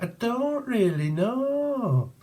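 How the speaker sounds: very high, drawn-out northern English voice; northern English accent. Acted. Playing someone from up north, high slow northern English. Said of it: "it could be from up north".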